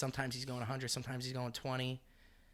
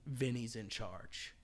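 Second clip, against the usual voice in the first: a slow, softer reading of the wife's words; slow quiet quote; 2 semitones lower, 7 dB quieter, much slower than usual. Retold or imitated. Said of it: "His wife said".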